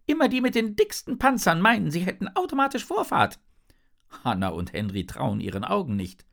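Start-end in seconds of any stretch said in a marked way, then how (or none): 0.1-3.4 very high, loud little fish voice; indignant flute fish. A narrator's reading. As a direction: squeak out a quick, outraged complaint. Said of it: "meint der Flötenfisch". (hohe, empörte Fischstimme)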